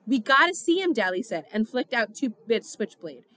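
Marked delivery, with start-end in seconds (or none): 0.1-0.9 higher and louder, desperate insistence; desperate demand. A narrator's reading. Dally demanding the doctor let them in, loud and desperate. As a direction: push past the doctor with raised voice. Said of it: "His voice was shaking".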